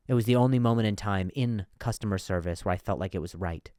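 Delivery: ordinary delivery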